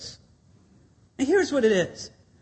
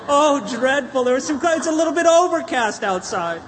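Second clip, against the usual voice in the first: a touch of mock complaint, slightly louder and higher; mock whining; 5 semitones higher, 3 dB louder than usual. Pastor mimicking locals complaining about clouds, mock whine. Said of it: "we whine and complain".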